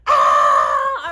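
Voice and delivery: sudden loud scream of pain. Retold or imitated. Wife screaming when husband presses her hurt foot. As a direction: yelp out as the sore foot is pressed. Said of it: "I'm, like, screaming".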